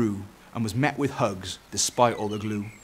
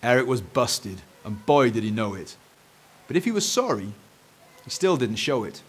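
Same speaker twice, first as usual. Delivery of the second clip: ordinary delivery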